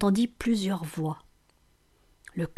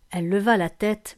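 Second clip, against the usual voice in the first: ordinary delivery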